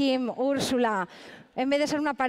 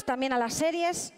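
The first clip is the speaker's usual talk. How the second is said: ordinary delivery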